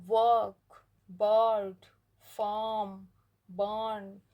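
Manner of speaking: वर्क and बर्ड with a long drawn vowel, no r; long vowel, teacher demo. Shown as a demonstration. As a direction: stretch the vowel, r silent. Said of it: "यहाँ पे long जा रहा है".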